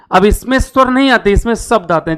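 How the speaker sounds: ordinary talk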